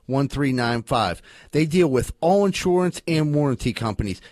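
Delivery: punchy local commercial read; emphatic appliance-store pitch; sponsor ad read. Acted. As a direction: sell it to the listeners. Reads an appliance repair ad.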